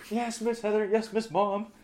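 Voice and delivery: high, sing-song yes ma'am; mock obedient. Mocking obedient reply.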